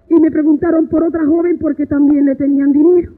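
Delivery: normal talking voice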